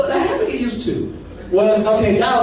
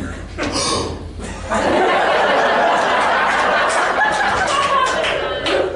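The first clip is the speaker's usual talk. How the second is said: big high shocked gasp; hammed-up gasp of offense; mock shocked gasp; exaggerated gasp. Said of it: "I gotta act like how he did".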